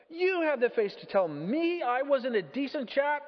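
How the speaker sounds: high, affronted protest; outraged disbelief. Acted. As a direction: splutter in offended astonishment. Affronted ghost answers in disbelief.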